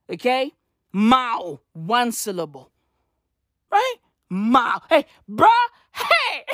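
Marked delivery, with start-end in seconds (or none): 5.9-6.5 a yelped hey breaking into laughter; high shouting hey with laugh. Acted. Comedian yelling at the audience, then laughing.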